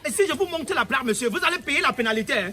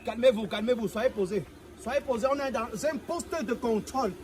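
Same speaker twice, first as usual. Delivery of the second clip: calming order; 4 semitones lower, 6 dB quieter than usual. Acted. Officer tells driver to calm down.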